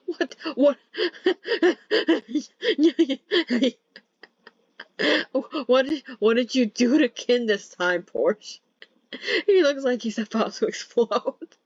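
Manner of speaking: high, laughing through the words. Acted. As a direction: talk through the giggles. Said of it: "bursting out in laughter".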